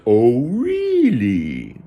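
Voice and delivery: loud, high, very drawn-out frog reaction; hammed-up froggy wonder; amazed frog. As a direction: stretch it way out in cartoon amazement.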